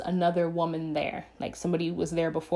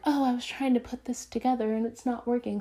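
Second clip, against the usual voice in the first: lightly imitated sweet innocence, higher voice; playing it cool, higher-pitched; smiling, acting cool; 5 semitones higher than usual. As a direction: say it through a casual fake smile. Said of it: "just smiling like".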